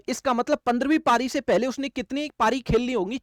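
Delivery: ordinary talk, nothing marked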